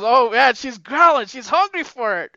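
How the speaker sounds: high, loud acted excitement; mock excited. Excited boy thinking she's growling for him, hammed-up excitement. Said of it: "he gets all excited".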